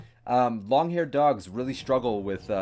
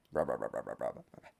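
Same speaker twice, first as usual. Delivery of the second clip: playful growl babble; 11 semitones lower, 10 dB quieter, faster than usual. Pretend.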